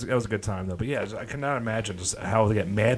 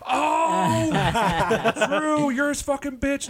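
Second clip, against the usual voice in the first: high, slow laughing taunt; mock taunting laugh; much slower than usual. Friends mocking Drew, high and laughing. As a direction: cackle the taunt out.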